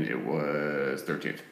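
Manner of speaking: low, slow, eerie drop; low haunting voice. Acted. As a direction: let it sink down like a ghost story. Halloween, unlucky number thirteen.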